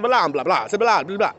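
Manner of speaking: loud hammed-up preacher blah blah; mock preacher babble. Mocking preachers with loud fake-sermon babble. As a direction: belt nonsense like a showy preacher. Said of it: "I've been wanting to do that".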